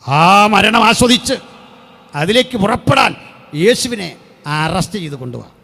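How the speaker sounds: fiery preaching. Acted. Preacher proclaiming the Passion to his congregation at full force. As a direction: thunder it out from the pulpit.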